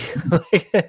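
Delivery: bright burst of laughter. Real, not acted. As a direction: laugh at your own joke. Laughing at own joke.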